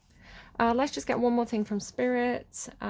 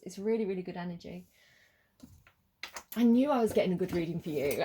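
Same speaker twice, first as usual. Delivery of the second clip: normal talking voice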